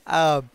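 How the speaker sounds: laughing at the superhero image; loud laugh. Real, not acted. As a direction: let the laugh take over. Laughing at the memory.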